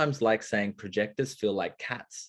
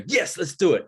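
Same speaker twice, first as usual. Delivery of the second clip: higher, louder gung-ho agreement; excited yes; 8 semitones higher, 6 dB louder than usual. Retold or imitated. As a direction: imitate the roommate jumping in. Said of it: "He'd go".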